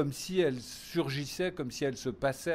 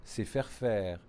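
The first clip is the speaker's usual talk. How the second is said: ordinary delivery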